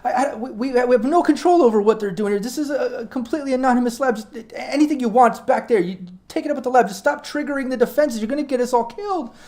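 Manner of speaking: high, rushed, desperate pleading; frightened pleading. Acted. Terrified med tech begging the intruders to leave. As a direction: beg them to stop, voice high with fear.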